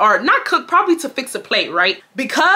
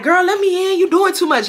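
Wesley pleading; 9 semitones higher than usual. Retold or imitated. Wesley voiced at the door.

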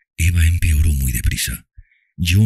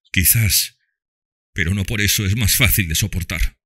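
dry hard tone; 5 semitones higher than usual. A narrator's reading. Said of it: "con un acento seco, duro e implacable".

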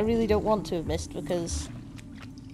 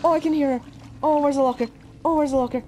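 really scared; 6 semitones higher than usual. Real, not acted. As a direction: call out in mounting terror, desperate to hide. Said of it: "I'm so scared".

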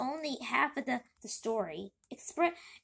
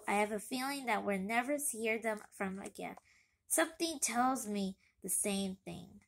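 plain reading voice

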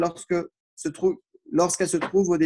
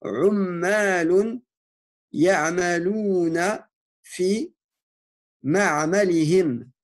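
slow, deliberate dictation of Arabic words; careful teacher dictation with pauses between words; much slower than usual. Shown as a demonstration. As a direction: dictate each word slowly so students can write it. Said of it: "dernière phrase".

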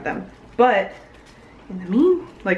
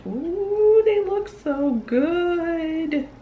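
high delighted coo; 11 semitones higher, much slower than usual. Real, not acted. Admiring stickers on camera.

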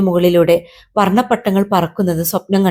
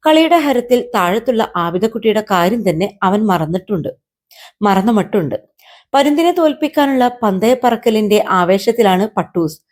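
usual speaking voice

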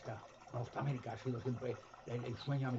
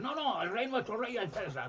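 crowd shout; 8 semitones higher, 4 dB louder than usual. Retold or imitated. Crowd answering Pilate.

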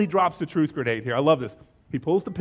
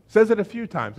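ordinary talk

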